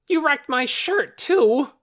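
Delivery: high, loud angry shout. A narrator's reading. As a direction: yell it in outrage. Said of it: "shouted Torin".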